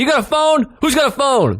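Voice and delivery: high, urgent demand; freaking out. Retold or imitated. Carjacking victim demands a phone from strangers, freaking out. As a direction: imitate a panicked man begging anyone for help. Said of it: "I'm freaking out, dude".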